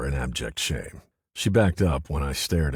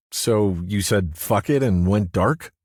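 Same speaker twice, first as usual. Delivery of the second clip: tight with anger; 5 semitones higher, 5 dB louder than usual. Acted. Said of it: "her voice now tight with anger".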